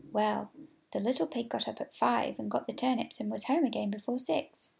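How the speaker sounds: usual speaking voice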